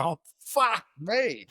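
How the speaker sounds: high, drawn-out stunned reaction; stunned awe. Retold or imitated. Young surfer facing his hero's wild entrance, retold stunned awe. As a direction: draw it out high, floored by what just arrived. Awed reaction to Kong bursting in.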